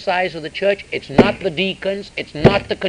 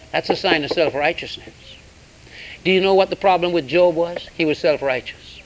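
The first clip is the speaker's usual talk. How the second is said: ordinary talk, nothing marked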